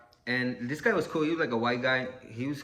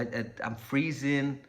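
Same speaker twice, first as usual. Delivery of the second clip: ordinary delivery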